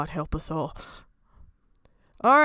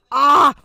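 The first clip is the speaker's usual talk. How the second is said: short loud war yell; battle cry; 3 semitones higher, 9 dB louder than usual. Acted. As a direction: roar it like a charging warrior.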